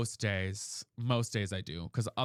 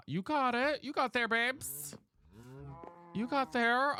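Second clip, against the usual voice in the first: sing-song praise; 10 semitones higher, slower than usual. Real, not acted. Praising chat for guessing sapling.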